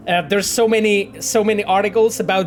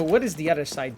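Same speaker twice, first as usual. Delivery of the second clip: normal talking voice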